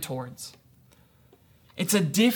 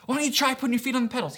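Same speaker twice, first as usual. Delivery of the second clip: higher, quick, unforced coaxing; gentle encouraging; 6 semitones higher, much faster than usual. Retold or imitated. Dad re-enacting coaxing his toddler to pedal, encouraging. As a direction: suggest it lightly to a small child. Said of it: "And I'm encouraging, I'm urging him".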